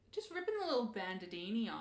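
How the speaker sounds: normal talking voice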